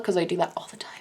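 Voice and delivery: soft unvoiced confession; a sheepish whispered aside. Real, not acted. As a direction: drop to a whisper, admitting it. Confessional aside about makeup.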